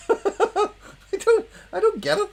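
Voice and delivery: high, laughing, baffled. Real, not acted. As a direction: laugh through the words. Laughing at own joke.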